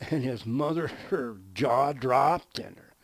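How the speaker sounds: ordinary delivery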